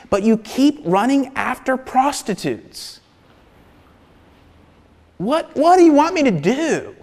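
high, impassioned listing of grievances; exasperated God. Retold or imitated. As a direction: pour out the hurt as God. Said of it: "sit you down and say".

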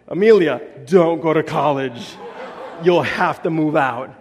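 put-on loud, earnest little boy warning his sister; kid's warning. Son turning to his sister, loudly warning her. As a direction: call out to her like a serious seven-year-old.

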